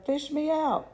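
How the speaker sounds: high desperate call; boy begging to be fished out, high; high pleading yell. Acted. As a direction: shout up from the river. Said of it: "he yelled".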